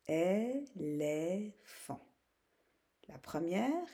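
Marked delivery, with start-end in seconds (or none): 0.1-2.0 "éléphant" in three long, separate syllables. Shown as a demonstration. Teacher repeating for pupils, syllable by syllable. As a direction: say "é, lé, phant" very slowly. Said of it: "la deuxième ou la troisième syllabe".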